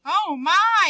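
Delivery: high, loud breathless gasp; sharp surprised gasp. Shown as a demonstration. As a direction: gasp it out in shock. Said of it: "he gasped".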